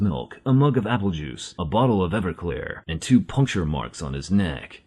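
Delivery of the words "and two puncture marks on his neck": ordinary delivery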